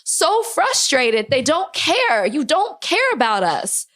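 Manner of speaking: high, slow opening, real frustration; frustrated. Real, not acted. Podcaster says politicians don't care, frustrated. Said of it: "that's why I'm just, like, so frustrated".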